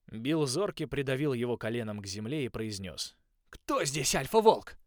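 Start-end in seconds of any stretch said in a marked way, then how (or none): 3.5-4.8 menacing. A narrator's reading. Coach pinning wolf down.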